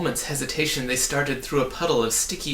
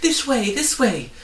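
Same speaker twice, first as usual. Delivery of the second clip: much higher and louder call; 12 semitones higher, 6 dB louder than usual. A narrator's reading. Hidden voice guiding the travellers, calling out. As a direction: call them toward you. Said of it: "the voice called again".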